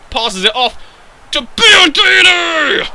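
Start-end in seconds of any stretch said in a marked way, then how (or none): 1.3-2.9 high, loud, drawn-out name call; excited commentator shout. Real, not acted. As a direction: stretch the player's name into a yell. Commentary peaks on the pass.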